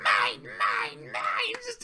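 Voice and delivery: high squeaky mock grabbing voice; possessive, high-pitched repeated squeal; greedy Rarity. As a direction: imitate a greedy cartoon hoarder. Imitating Greedy Rarity grabbing things.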